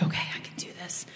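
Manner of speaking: hushed, breathy self-reassurance; a private whispered pep talk; whispered to herself. Retold or imitated. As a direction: whisper it under your breath to steady yourself. Bracing self at nurse's office.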